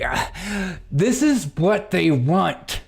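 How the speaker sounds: slow, drawn-out mock condescension; condescending. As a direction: imitate a patronizing explainer, stretching it out. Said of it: "she is so condescending".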